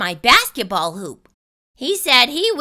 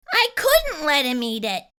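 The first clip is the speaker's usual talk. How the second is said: ordinary delivery, nothing marked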